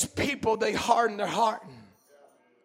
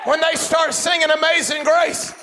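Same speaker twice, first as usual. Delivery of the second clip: high, loud exhortation from the pulpit; full-force preaching; 6 semitones higher, 6 dB louder than usual. Real, not acted. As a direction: plead with the congregation at a shout.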